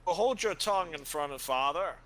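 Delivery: loud stern warning; stern rebuke. Acted. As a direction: bark a scolding order. GM voices Ulfrig scolding.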